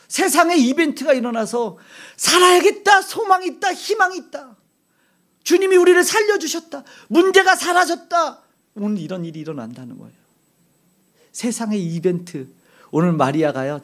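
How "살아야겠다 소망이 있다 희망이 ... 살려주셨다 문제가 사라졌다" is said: high, ringing cry of hope; preacher voicing the saved, exultant and high; triumphant proclamation. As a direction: shout it out like a rescued soul.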